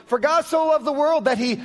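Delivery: hammed-up pulpit parody; mock scripture. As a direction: ham up the verse as a hesitant giver. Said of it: "parody of a stingy giver".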